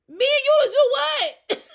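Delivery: high, loud incredulous shout; outraged yell. Retold or imitated. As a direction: fire it back, appalled. Indignant answer to him.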